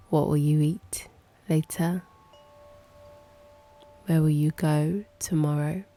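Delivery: ordinary talk, nothing marked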